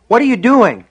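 high-pitched, loud, horrified question; frightened boy crying out; alarmed cry. A narrator's reading. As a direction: demand an answer in panic. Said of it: "Sean cried".

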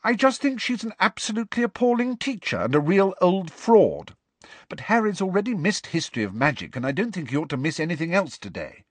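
put-on know-it-all girl laying out her case; Hermione, prim. A narrator's reading. Hermione explaining to Ron and Harry, prim and quick.

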